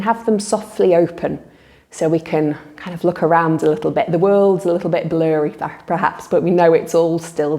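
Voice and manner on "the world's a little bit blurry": normal talking voice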